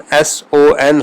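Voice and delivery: slow letter-by-letter S O N; spelled slowly. Shown as a demonstration. Teacher spelling English son to show Persian noon. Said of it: "انگریزی کا لفظ اگر سن".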